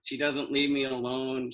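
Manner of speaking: normal talking voice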